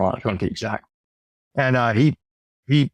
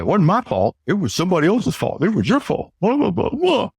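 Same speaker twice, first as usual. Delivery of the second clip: whiny excuses climbing higher and higher; mock defensive; 7 semitones higher than usual. Salesman mocking a defensive seller, whiny and rising. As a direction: mimic a defensive rep dodging blame. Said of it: "get defensive and say".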